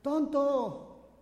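high shouted "¡Tonto!"; child's angry yell; kid's shout. Retold or imitated. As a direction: yell it into the cave. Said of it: "el niño gritó".

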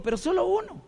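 high-pitched wheedling plea; coaxing. Retold or imitated. Husband coaxing his wife to try one Sunday, high wheedle. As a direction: talk her into just one, voice rising high.

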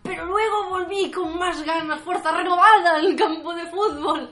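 hammed-up sports ad narrator; mock heroic ad kid. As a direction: pump it up like an epic comeback. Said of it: "Entonces dice".

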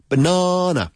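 BANANA as "buh-NAH-nuh", high on NAH; teacher's model "ba-NA-na"; second-syllable stress. Shown as a demonstration. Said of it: "Pues la segunda".

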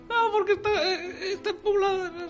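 very high, soft little voice; comic high-pitched character, laughing slightly; put-on comic character. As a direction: explain it in a tiny falsetto. Same comic skit continues.

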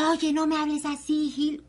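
imitating yelling at a rude person; mock yell. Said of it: "Cuando yo le estoy gritando".

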